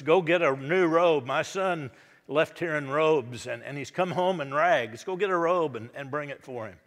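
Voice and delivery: a joyful father rushing servants along; eager father. Acted. Father calls to servants for a robe, loud and eager.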